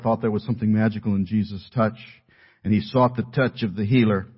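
normal talking voice

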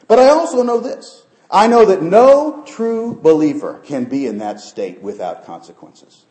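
loud, high preaching, slowing for weight; forceful preaching. Real, not acted. Preacher warning true believers of consequences, forceful preaching.

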